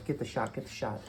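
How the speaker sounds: ordinary talk, nothing marked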